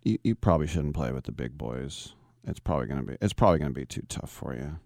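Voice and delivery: put-on father baiting his son about the big boys, low and dry; dad's cold taunt. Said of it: "my dad would say".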